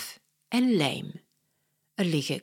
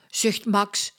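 ordinary delivery

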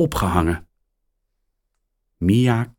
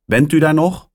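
higher, louder woman caller; a caller asking if anyone is there; phone caller voice; 8 semitones higher, 5 dB louder than usual. A narrator's reading. Woman asks caller, higher and louder.

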